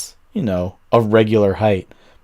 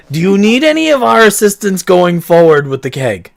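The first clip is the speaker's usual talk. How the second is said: laughing offer; 6 semitones higher, 5 dB louder than usual. Acted. Barkeep offers help.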